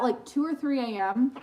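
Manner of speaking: ordinary delivery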